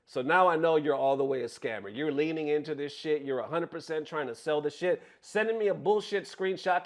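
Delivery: ordinary talk, nothing marked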